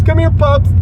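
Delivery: high coaxing call to a dog. Retold or imitated. Calling the stray by name, high coaxing. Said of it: "his name's Bubs".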